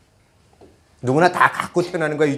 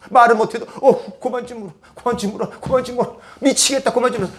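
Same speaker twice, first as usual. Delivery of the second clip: much higher, half-laughing mock exasperation; a frazzled parent at the end of their rope, with a laugh; exasperated parent; 7 semitones higher than usual. Tired parent to crying baby, mock exasperated and much higher. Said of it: "속마음속에는 어떤 생각이 드세요?".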